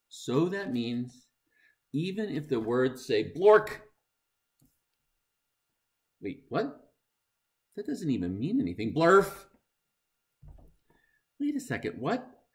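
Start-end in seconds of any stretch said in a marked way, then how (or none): 3.3-3.8 loud high burst; blurted nonsense. Acted. Reader forced to say a silly word, loud. 8.9-9.4 loud high burst; blurted nonsense. Acted. Reader forced to blurt a nonsense word, loud. 11.4-12.4 high bewildered exclaim; mock confusion. Reader acting baffled by the book, high.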